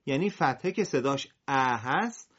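ordinary delivery

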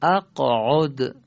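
"اقعد" slow and loud, the qaf bounced before the next syllable; qalqala on qaf. Shown as a demonstration. Teacher models for students to repeat, "اقعد" slowly with bounced qaf. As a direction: say "اقعد" slowly, releasing the qaf with a small echo. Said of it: "به این قاف خوبه که قلقله بدیم".

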